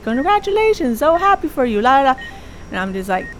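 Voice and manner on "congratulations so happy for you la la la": high, bubbly congratulations, trailing into la la la; gushing caller. Retold or imitated. Show staff congratulating the contestant, gushing high and bright. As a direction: play a chirpy TV show caller. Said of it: "and they're like".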